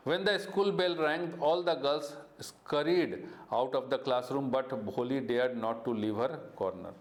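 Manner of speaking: slow, deliberate read-aloud; storybook narration for students; story reading. A narrator's reading. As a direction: read the textbook line slowly to the class. Teacher reads story text aloud.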